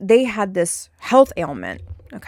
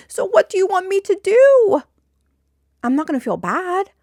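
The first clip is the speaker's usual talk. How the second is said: higher and louder, challenging; exasperated demand; 10 semitones higher, 5 dB louder than usual. Real, not acted. Challenging coworkers via viewers, loud exasperated demand. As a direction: demand it in real frustration.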